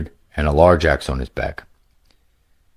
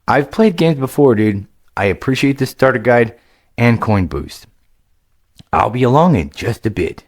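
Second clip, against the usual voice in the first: put-on lightly raised, eager young gamer voice; a casual young player, a bit higher than the narration; upbeat young adventurer, higher pitched; young hero Greylick; 6 semitones higher, 3 dB louder than usual.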